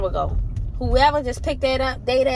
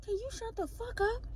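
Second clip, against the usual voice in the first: muttered retort; 12 semitones higher, 13 dB quieter than usual. Retold or imitated.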